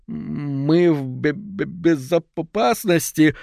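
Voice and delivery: slow, broken stammer. Acted. Finchley stutters trying to calm everyone, stammering. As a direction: trip over every syllable. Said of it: "заикаясь".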